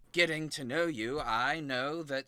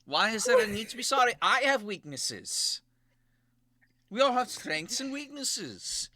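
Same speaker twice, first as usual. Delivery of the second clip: defensive; 7 semitones higher, 6 dB louder than usual. Acted. Stony defensive, higher and louder.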